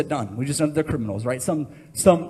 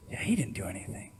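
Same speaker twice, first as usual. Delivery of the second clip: whisper; 8 semitones lower, 11 dB quieter than usual. Acted.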